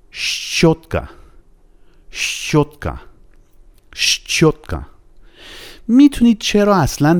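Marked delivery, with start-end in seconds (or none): 0.1-4.8 "щوتکا" opening on sh run straight into ch; shch-otka, no vowel inside the cluster of "щوتکا"; joined sh-ch. Shown as a demonstration. Teacher modelling the brush word for learners, joined sh-ch. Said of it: "به همین شکل هم اینجا هم ش، چ".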